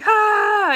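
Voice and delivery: high, loud mock panic cry; mock freak-out cry. Mocking the panicked TikTok crowd from other towns, loud mock cry. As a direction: imitate a frantic outsider's yelp. Imitating freaked-out out-of-town people.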